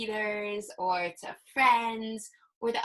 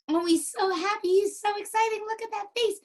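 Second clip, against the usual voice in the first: high, gushing delight; bubbly, high-pitched excitement; excited; 10 semitones higher, 4 dB louder than usual. Shown as a demonstration. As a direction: gush over it, pitched right up. Gushing over happy picture, modelling excitement.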